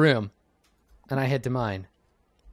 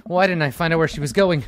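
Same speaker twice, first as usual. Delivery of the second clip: usual speaking voice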